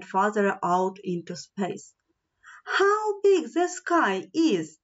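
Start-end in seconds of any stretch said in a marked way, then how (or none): 2.6-4.8 high-pitched cry of wonder; excited cry. A narrator's reading. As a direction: draw it out in amazement, pitched high. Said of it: "the passengers cried".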